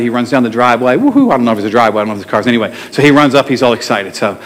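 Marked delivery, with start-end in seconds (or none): 1.0-1.3 high-pitched mock whoop; quick, high cheer in imitation; imitated excitement. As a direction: whoop like the delighted man, playfully. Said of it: "The guy was so excited".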